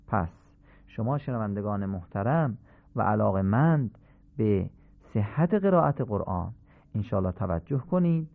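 ordinary talk

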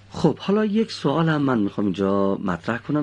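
usual speaking voice

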